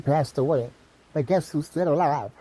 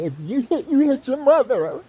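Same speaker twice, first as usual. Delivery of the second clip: much higher, louder parental scolding; mock a preachy parent, pitched way up and loud; 11 semitones higher, 4 dB louder than usual. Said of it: "A lot of parents say".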